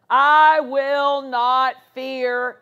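loud, very slow quoted vow; bold declaration. Retold or imitated. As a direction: declare it slowly and forcefully against fear. Quoted bold declaration against fear.

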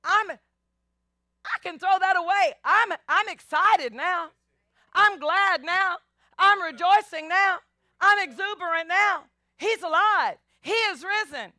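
bursting with new joy; joyful excitement. Retold or imitated. Women leaving the tomb, rejoicing with loud excitement. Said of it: "no, they're not on the way".

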